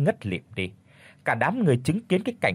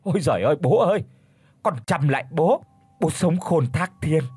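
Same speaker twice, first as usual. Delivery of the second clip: terrified pleading; 3 semitones higher than usual. A narrator's reading. Old man begs his dead father's spirit, frightened wailing. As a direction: beg the dead father for help in panic. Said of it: "hãi hùng quỳ mọp xuống đất vái lấy vái đẻ".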